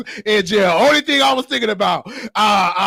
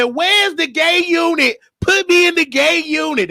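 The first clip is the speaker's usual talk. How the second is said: eager plea; 9 semitones higher than usual. Retold or imitated. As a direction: plead eagerly, pitched way up.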